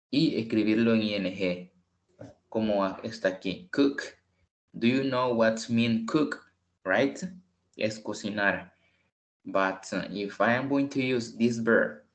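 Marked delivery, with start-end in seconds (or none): none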